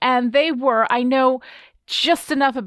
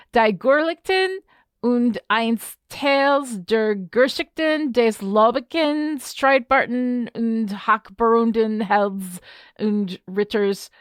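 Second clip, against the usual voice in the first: slow, halting attempt at reading German; bad German accent; slower than usual. Real, not acted. Podcaster reading out an old German title, bad German accent. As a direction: stumble slowly through German in an American accent. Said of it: "that is a really bad German accent".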